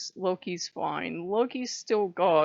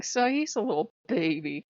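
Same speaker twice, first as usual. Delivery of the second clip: normal talking voice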